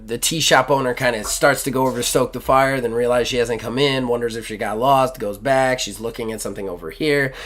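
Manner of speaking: usual speaking voice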